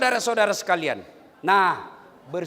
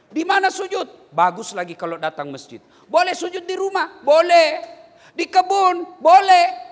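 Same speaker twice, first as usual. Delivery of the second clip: normal talking voice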